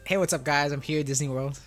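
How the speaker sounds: ordinary talk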